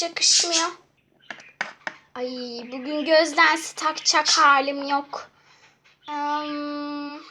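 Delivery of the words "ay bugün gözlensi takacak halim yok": ordinary talk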